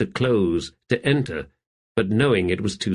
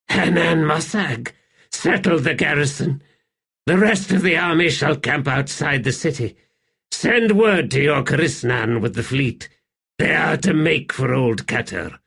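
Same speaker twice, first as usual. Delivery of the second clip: put-on commanding young emperor; 5 semitones higher than usual. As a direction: bark out orders to the warlock king. Rulad voiced giving orders.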